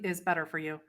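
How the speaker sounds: ordinary talk, nothing marked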